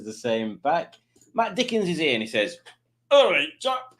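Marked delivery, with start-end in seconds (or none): 3.1-3.9 high put-on voice; a hint of a jolly chap greeting; mock impression. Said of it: "that's exactly how Matt Dickinson speaks".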